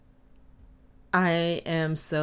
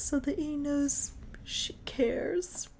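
whisper; 10 semitones higher, 4 dB quieter, slower than usual. Real, not acted. Dreamy aside about the kiss.

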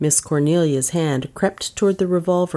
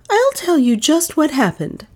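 put-on much higher and louder young woman's voice; Dale giving in; 11 semitones higher, 4 dB louder than usual. Dale cornered by the detective, starting her confession high and loud.

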